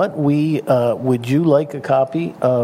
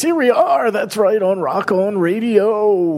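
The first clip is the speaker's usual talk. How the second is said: put-on high, slow, old-time radio announcer; big booming AM DJ delivery; 8 semitones higher, slower than usual. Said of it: "Give me an A M voice".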